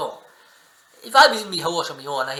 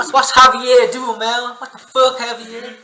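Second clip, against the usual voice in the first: exasperated shout; 8 semitones higher, 9 dB louder, slower than usual. Real, not acted. Yelling at Javier on screen.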